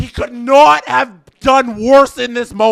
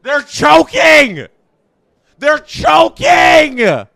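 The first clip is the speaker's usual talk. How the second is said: slow, loud cry of alarm; alarmed shout; 4 semitones higher, 3 dB louder, slower than usual. Real, not acted. Fan on stream watching his team collapse, slow alarmed shout. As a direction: yell it as the team falls apart.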